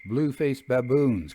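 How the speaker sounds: ordinary delivery, nothing marked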